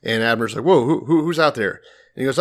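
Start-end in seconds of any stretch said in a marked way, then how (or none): 0.6-1.8 mock-startled, higher and stammering. General woken by yelling from afar, mock startled. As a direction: act jolted awake, stumbling on the first word. Abner startled awake by the yell.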